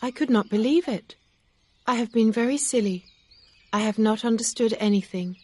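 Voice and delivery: put-on high, quick voice for upset young Emma. A narrator's reading.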